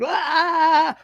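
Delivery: sharp, high, loud yelp of alarm; startled dog yelp. Retold or imitated. Trainer voicing a dog startled at the open door, high loud yelp. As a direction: let out a sudden startled yelp. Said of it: "your dog is like".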